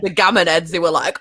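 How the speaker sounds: ordinary talk, nothing marked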